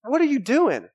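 wife catching a mistake, alarmed high call; wife's alarmed call. Retold or imitated. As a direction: call out in alarm to your husband. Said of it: "She goes".

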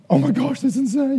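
high, loud burst of delight; thrilled. Retold or imitated. Thrilled at a gift from God, excited. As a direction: gush with excitement. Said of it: "you are gonna be like".

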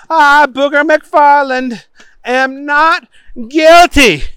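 high, loud proclamation; oath-taking Booger. A narrator's reading. Booger repeating to the judge, loud and overblown. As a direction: proclaim the plea grandly at high pitch. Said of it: "said Booger".

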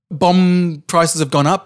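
normal talking voice

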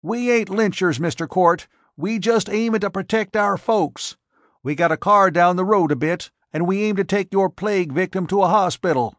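put-on high-pitched yelling old farmer; shrill yelled protest. A narrator's reading. Old spokesman yelling his protest at Court, high. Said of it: "The oldster yelled a shrill protest".

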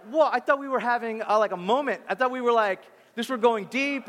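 mock letdown. Wife reacting to toddler's sudden song, mock letdown. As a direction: play up the baffled disappointment. Wife's baffled reaction, acted.

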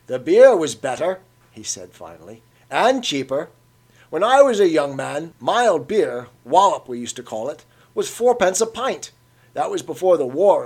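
put-on an old man on the good old days; reminiscing old Cockney. A narrator's reading.

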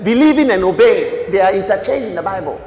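loud, high preaching cry; full-force preaching. Acted. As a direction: preach it out to the hall. Preaching to congregation.

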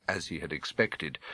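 ordinary delivery, nothing marked

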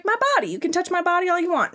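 put-on high, quick girly offer; high mock girl voice, eager; mock anime girl. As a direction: rush it like a bashful heroine. Voicing the girl in the hentai.

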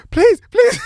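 very high, frantic comic begging; mock desperate pleading. Man knocking on police car door, mock desperate pleading. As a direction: beg in a squealed, hammed-up panic.

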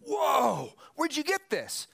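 high-pitched mock astonishment; hammed-up "whoa" of delighted surprise; acted amazement. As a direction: burst out as if stunned by the taste. Acting out head waiter's amazed sip.

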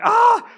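loud high yelp of alarm; startled yelp. Retold or imitated. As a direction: yelp as the car swerves. Startled yelp in traffic story.

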